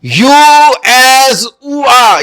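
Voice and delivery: یو ایز او آ said slowly and loud, letter by letter; teacher stating the spelling rule; slow spelled-out rule. Shown as a demonstration. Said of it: "جب او آ کی آواز دیتا ہے".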